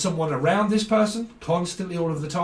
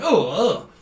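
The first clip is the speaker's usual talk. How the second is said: mock disgust; 4 semitones higher, 5 dB louder than usual. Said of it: "they're kinda like".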